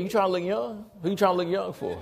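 high-pitched teasing interrogation; mock nosy questioning. Imitating people teasing about his dyed beard, mock nosy questioning.

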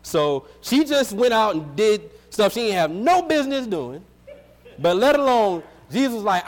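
ordinary delivery

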